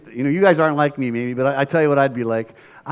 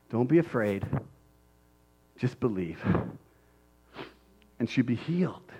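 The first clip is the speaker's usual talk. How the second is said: soft, lower, savoring each phrase; softly repeated; 5 semitones lower, 10 dB quieter than usual. Shown as a demonstration. Preacher repeating Jesus's words softly in awe.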